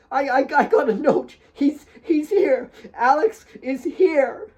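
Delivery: cling to the hope, pleading; high desperate stammer; panicked denial. A narrator's reading. Kate denying in panic.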